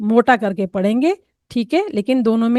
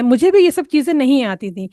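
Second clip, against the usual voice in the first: ordinary talk, nothing marked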